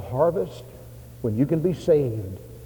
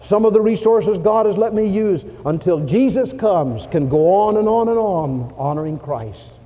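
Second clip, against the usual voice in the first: raised, fervent plea to the pastor; earnest request; 8 semitones higher, 6 dB louder than usual. Retold or imitated. Giving man asks the pastor for help, fervently. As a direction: lift the voice with conviction. Preacher voices a donor's request.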